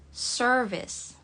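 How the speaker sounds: American "service" with the r sounded; American accent. Shown as a demonstration. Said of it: "Jadi kalau dalam American". (logat American)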